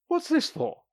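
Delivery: high, puzzled question; flustered doctor. Acted. Doctor asks Sasha about the gift, high flustered question. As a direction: ask it pleased and flustered.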